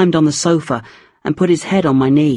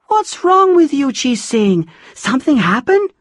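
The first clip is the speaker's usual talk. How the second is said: concerned Puri; 9 semitones higher than usual. A narrator's reading.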